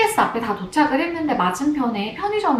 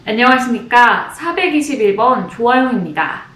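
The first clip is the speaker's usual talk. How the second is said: crisp, louder formal announcer greeting; announcer audition self-introduction; 5 dB louder than usual. Shown as a demonstration. An announcer hopeful shows her practiced audition greeting, crisp and formal. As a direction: greet the panel like a polished announcer candidate. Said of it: "수도 없이 연습했던 자기소개인데요".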